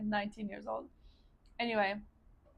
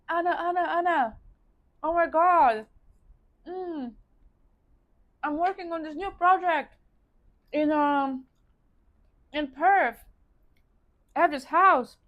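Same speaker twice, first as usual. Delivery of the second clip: higher, louder excited phone call; excited friend; 6 semitones higher, 7 dB louder than usual. Retold or imitated. Best friend calls Anna about his new project, excited. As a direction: call out with eager urgency, imitating a friend.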